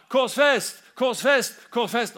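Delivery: loud, high chanted demand; hammering crowd cry; crowd shouting. Retold or imitated. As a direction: yell it full force like a mob. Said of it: "Nå hører han".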